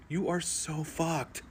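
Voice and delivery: very soft, slow, breathy dread; almost whisper it to yourself, slowly; hushed inner thought. Retold or imitated. Husband watching his wife turn back, hushed and slow.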